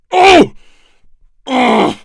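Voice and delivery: very high, loud cry then grunt; pained cry. Acted. Chapman cries out as his host rebels. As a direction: cry out as the body fights back. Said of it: "Chapman cried out".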